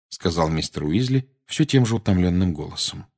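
plain reading voice

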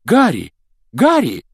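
high call across the street; loud calling. Acted. Hermione calls to Harry from the bank steps, loud call. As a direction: call out his name loudly. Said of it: "громко позвал чей-то голос".

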